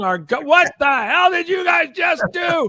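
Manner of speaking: high, loud outraged yell; imitated screaming. Other labels call the promoters in outrage, mock screaming. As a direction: shout it like a furious rival calling up.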